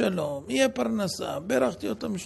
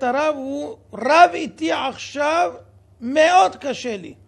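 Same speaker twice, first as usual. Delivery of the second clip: upset plea; 4 semitones higher, 7 dB louder than usual. Retold or imitated. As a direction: read out loud and slow, pained. Reading a distraught woman's message. (קריאה חזקה ומיוסרת)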